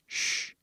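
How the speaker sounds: a soft drawn-out sh with no voice; hushing sh. Shown as a demonstration. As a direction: hiss a quiet sh sound. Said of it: "nós falamos sh".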